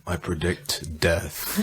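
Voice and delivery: low, slow and soft mock doom; drawn-out deadpan goth forecast; goth weatherman. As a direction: intone a gloomy forecast, dropping low. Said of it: "the goth weatherman".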